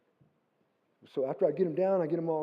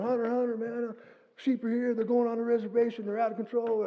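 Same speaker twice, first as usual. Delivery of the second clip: high, loud urgent call through the door; 9 semitones higher, 3 dB louder than usual. Retold or imitated. Waking Hunter in his tent, loud and urgent. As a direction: raise the alarm, you need him now.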